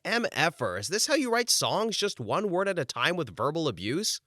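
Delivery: high, exasperated mock outburst; indignant question. Outraged inner reply to rude star.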